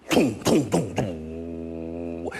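low, slow mimicked drumbeats; drum imitation. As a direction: beat the drum slowly with your voice. Imitating drums. (低沉缓慢地模仿鼓声)

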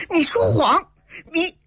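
high-pitched, heated outburst; indignant accusation. A narrator's reading. Heroine calls the stranger a liar, indignant. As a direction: throw the accusation at her, high.

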